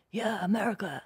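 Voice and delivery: a hammed-up American accent. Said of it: "that was a terrible accent".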